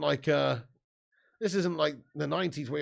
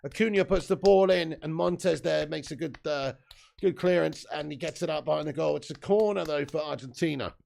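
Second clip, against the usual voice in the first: raised, lively match commentary; live play-by-play; 4 dB louder than usual. Acted. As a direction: call the action like a football commentator. Streamer calls the match live.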